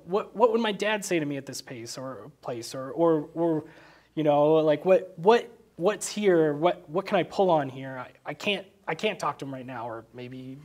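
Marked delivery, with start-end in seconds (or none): none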